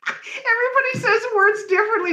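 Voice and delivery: high-pitched, talking through laughter; really cracking up while speaking; helpless laugh pushing the voice up high. Real, not acted. Cracking up over mispronouncing a word.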